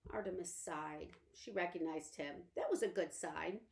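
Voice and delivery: ordinary delivery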